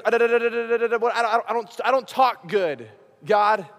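lightly imitated stammer, repeating da; mock stutter. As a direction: stumble over the syllables like a stutterer. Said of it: "I have a stutter".

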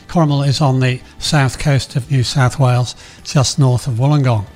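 ordinary talk, nothing marked

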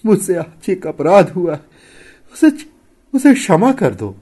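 high, loud tearful pleading; sobbing confession. A narrator's reading. Wife confessing an offence to her husband, sobbing. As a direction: beg forgiveness through acted sobs. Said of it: "सिसकते हुए कहा".

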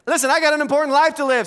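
fast, high and loud, in one run; urgent restatement. Real, not acted. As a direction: drive it home in one quick burst. Preacher urgently restates the repeated line.

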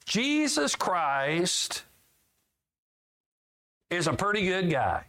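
mock wrong version. Shown as a demonstration. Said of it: "Jesus Christ is someone you can almost always depend".

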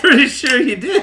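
high, loud laughing tease. Real, not acted. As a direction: tease him through laughter. Teasing while laughing.

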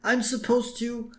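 high, thin-volume schoolboy whine; imitated high-pitched whimpering excuse; mock whining. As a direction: whine like a protesting pupil. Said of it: "And Warren starts whining".

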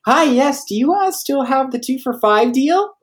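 very high, polite customer voice; talking too high. Retold or imitated. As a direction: pitch the question way up. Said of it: "maybe I'm talking too high".

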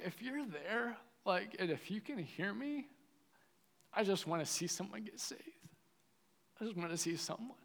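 soft, high-pitched pleading prayer; crying out to God. Retold or imitated. Young believer begging God to show up, soft pleading. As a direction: plead gently, pitch lifted. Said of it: "I was crying out to God".

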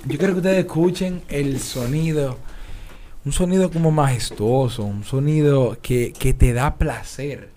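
milk each word like a solemn showman; grand product presenter. Acted.

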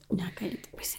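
breathy whisper, telling her to stop; whispered hush. Real, not acted. Embarrassed hush.

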